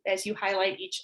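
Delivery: ordinary delivery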